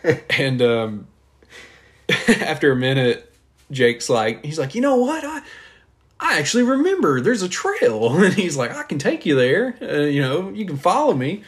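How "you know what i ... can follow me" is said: mock Jake voice.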